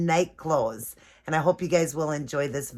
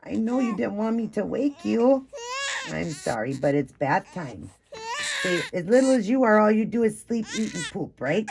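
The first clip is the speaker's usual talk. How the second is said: higher, cooing pretend baby talk to a doll; 5 semitones higher than usual. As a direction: coax the little one as if he's real. Mom talks to doll at bath.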